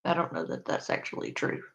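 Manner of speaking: low, quick mumbled aside; quiet mumble. Shown as a demonstration. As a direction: mutter it under the breath, fast. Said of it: "I just wanna be clear".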